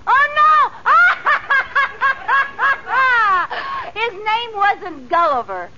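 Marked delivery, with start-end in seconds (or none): 0.1-0.4 delighted cry. Acted. Delighted at the horse's name. 0.5-4.0 delighted laugh. Acted. Bursts out laughing.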